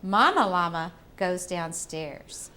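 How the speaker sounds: slow, sing-song storybook reading; reading to a toddler. Acted. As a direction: read it gently to little kids. Reading picture book to children.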